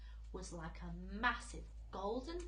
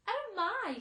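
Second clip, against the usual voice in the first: high, loud prim little gasp; prissy lady's exclamation; 12 semitones higher, 9 dB louder than usual. A narrator's reading. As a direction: ladybird tasting the peach, high and prim. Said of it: "said the ladybird primly".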